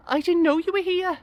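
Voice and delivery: very high, pleased female voice; woman's voice. Acted. Old school crush to guest, high woman's voice. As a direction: speak high as the surprised old friend.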